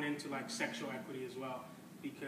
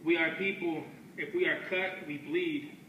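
measured, deliberate poetry reading with weighty pauses; spoken word poem; 4 semitones higher, 11 dB louder, slower than usual. Acted. Performing a poem on stage for an audience, in a measured spoken-word delivery. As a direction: let each line land like a verse.

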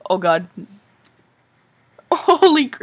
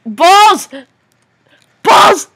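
frustrated yell; 7 semitones higher, 7 dB louder than usual. Real, not acted. Player losing in tense game.

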